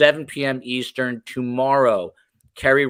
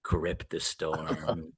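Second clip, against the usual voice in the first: creepy Chad echo; 7 semitones lower, 8 dB quieter, much slower than usual. Acted. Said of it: "echoing the Chad voice".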